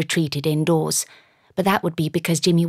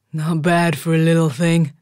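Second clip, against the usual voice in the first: giant voice; 4 dB louder than usual. A narrator's reading.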